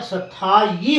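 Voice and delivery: सथाई slowed to "sathaa-yee"; slow, firm सथाई with a y glide; correct pronunciation. Shown as a demonstration. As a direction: stretch the thaa in सथाई, then a clear yee. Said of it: "सथाई स्कूलों पर गलत होता है".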